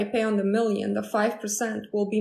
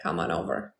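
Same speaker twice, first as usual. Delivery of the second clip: deep seductive invite; 6 dB quieter than usual. Retold or imitated. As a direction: drop way down like a smooth-talking ex. Ex inviting her over.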